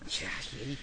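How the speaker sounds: whispered pity. Acted. Pitying the sick niece.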